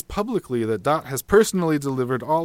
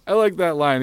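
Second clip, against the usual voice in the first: normal talking voice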